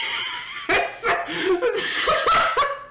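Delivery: high, breathless giggling fit; real hearty laughter. Real, not acted. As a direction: crack up at your own story. Laughing at own story.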